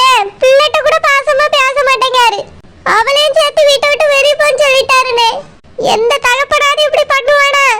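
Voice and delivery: usual speaking voice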